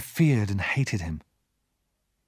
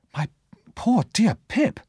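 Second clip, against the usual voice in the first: higher, slower cry of sympathy; exclaiming; 11 semitones higher than usual. A narrator's reading. Herbert pitying Pip, exclaiming. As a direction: exclaim in pity for a friend. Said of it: "Herbert exclaimed".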